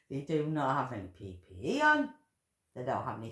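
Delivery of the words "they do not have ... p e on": ordinary delivery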